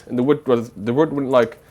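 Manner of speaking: ordinary talk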